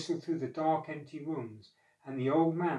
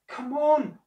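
high, loud call of encouragement; raised, insistent cat voice; urging; 11 semitones higher, 8 dB louder than usual. A narrator's reading. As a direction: urge a flagging friend on. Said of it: "urged the cat".

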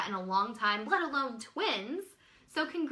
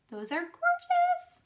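much higher, squealing delight; squealing over the purple pearls. Real, not acted. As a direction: squeal it like you can't contain it.

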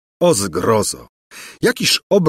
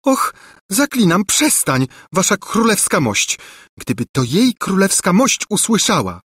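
lightly voiced begging servant girl, higher; pleading maid; 10 semitones higher than usual. A narrator's reading. Maid imploring the king, pleading. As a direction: beg him to stop, anxious. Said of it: "szepnęła błagalnie Ruzia".

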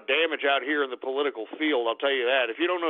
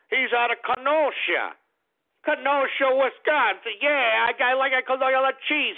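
higher mock Wisconsin accent, stretching Kenosha out; 7 semitones higher than usual. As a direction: ham up a Midwestern Wisconsin voice, mocking the locals. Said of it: "I don't know how these stupid people out there talk".

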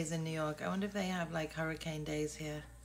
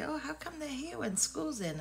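naive question; 10 semitones higher than usual. Retold or imitated. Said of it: "I said to Ashley".

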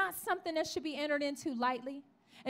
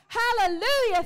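loud, raised hallelujah in praise; rejoicing; 5 semitones higher, 7 dB louder than usual. Retold or imitated. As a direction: burst out in happy thanks. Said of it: "he begins to rejoice".

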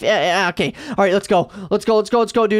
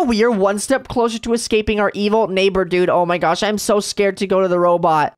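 ordinary talk

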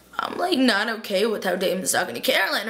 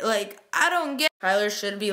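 ordinary talk

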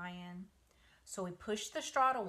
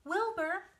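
high, loud call; 11 semitones higher, 5 dB louder than usual. Acted. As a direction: call the pig from across the barn. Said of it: "she called".

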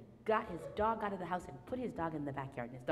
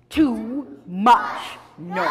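drawn-out, loud cry; exasperated complaint; 4 semitones higher, 15 dB louder, much slower than usual. Acted. Storyteller leads children in the man's complaint, exasperated. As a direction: wail it out slowly and loudly.

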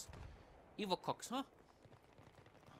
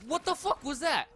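loud, high burst of disbelief; outraged shout; 8 semitones higher, 8 dB louder than usual. Real, not acted. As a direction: yell it in angry disbelief. Killed in game, yells.

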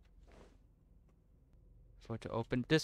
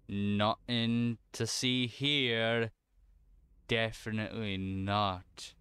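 slow drawn-out; much slower than usual. Real, not acted. Slow hushed reassurance to self.

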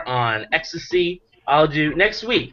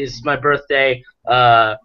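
ordinary delivery

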